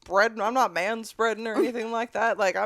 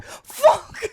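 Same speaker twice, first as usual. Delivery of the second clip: startled yelp; 11 semitones higher, 6 dB louder than usual. Retold or imitated. Seat jolted by cart.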